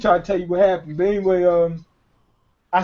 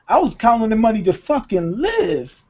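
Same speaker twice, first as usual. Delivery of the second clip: normal talking voice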